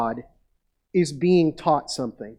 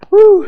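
high loud whoo of relief; relieved whoop; 14 dB louder than usual. Retold or imitated. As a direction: let out a joyful whoop. Parents relieved.

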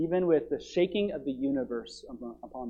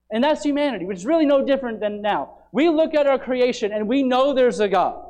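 loud, raised pulpit voice; forceful preaching; 7 semitones higher, 9 dB louder than usual. Acted. Preacher thundering to his church about humanity. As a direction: drive every clause home.

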